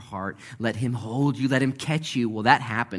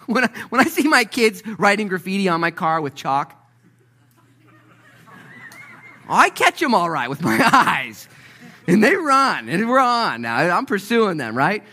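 high, very loud worked-up parent; full-force comic preaching; 11 semitones higher, 11 dB louder than usual. Real, not acted. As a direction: holler it as if giving chase. Comic preaching about chasing his kids.

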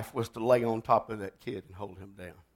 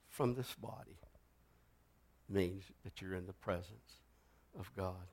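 solemn altar call; 6 semitones lower, 9 dB quieter than usual. Acted. Preacher closing the sermon to the congregation, hushed pleading. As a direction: lean in and plead quietly.

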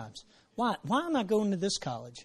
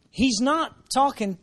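ordinary delivery

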